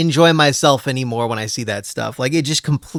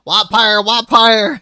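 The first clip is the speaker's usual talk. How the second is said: southern accent; 7 semitones higher, 5 dB louder, slower than usual. Shown as a demonstration.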